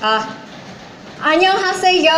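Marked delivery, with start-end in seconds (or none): 1.2-2.2 a Korean greeting, pitched up; a bright K-pop Korean greeting; Korean accent. Pretend. Guest performing a K-pop-style Korean greeting for the hosts. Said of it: "I can do the Korean accent".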